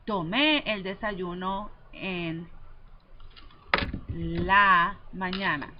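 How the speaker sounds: slow, careful reading of "Tomé el desayuno en la mañana"; slow, clear teacher model; slow model sentence. Shown as a demonstration. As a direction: read it out slowly for students to copy.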